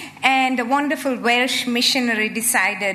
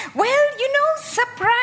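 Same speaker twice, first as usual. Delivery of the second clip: spring the surprise on them brightly; playful surprise; 12 semitones higher than usual. Real, not acted. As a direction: voice leaps up high for the reveal. Playful reveal to audience.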